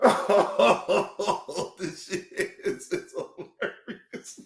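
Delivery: breathless laughter, words half whispered; real laugh, barely getting words out; laughing too hard to voice it. Real, not acted. Cracking up at the clip.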